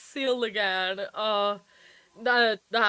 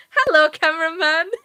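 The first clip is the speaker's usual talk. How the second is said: loud, higher, amused hello; excited greeting; 6 semitones higher, 12 dB louder than usual. Real, not acted. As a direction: call out to the cameraman through giggles. Spots and hails the cameraman.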